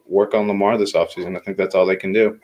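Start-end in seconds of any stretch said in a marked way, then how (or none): none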